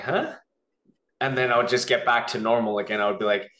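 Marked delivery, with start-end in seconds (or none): 0.0-0.3 sudden high confused huh; puzzled huh. Retold or imitated. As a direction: catch yourself, confused. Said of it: "I'm like".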